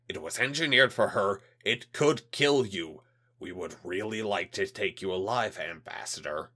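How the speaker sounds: a hint of a menacing alien; menacing alien official. Alien liaison warns.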